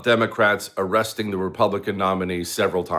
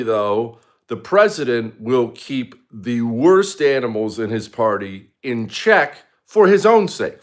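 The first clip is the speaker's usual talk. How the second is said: ordinary delivery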